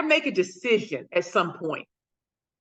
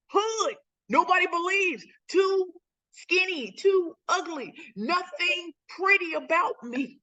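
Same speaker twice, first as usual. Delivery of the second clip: emotional; 9 semitones higher, slower than usual. Real, not acted. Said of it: "I'm sorry if I'm getting emotional".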